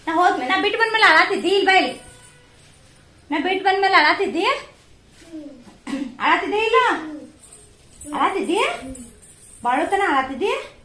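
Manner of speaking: insistent high-pitched prodding. Real, not acted. Mother quizzing child about crying.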